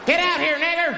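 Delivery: high, loud acted shout; sudden high-pitched holler; menacing yell. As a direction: yell it as an angry attacker. Hooded men yelling at caller.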